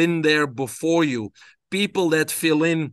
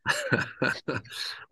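soft breathy laugh; 8 dB quieter than usual. Real, not acted. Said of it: "guest laughs at question".

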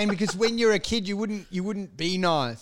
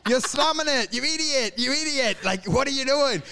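high-pitched mock outrage; exasperated scolding; 6 semitones higher than usual. Friend scolds mate for slumming it, high comic exasperation.

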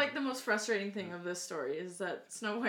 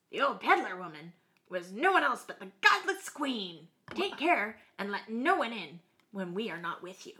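dwarfs voice; 5 dB louder than usual. Acted.

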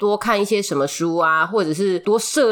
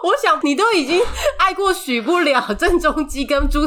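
laughing; 9 semitones higher than usual. Real, not acted. Teasing co-host about ex-girlfriends.

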